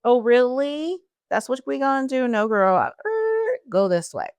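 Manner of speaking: sassy mocking voice of life; life talking back. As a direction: taunt her like fate laughing at her plans. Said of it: "And life said".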